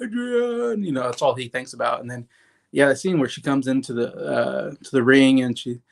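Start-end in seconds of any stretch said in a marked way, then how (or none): 0.0-0.8 high, falling call of the name; imitating Rocky calling for Adrian. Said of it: "and he's like".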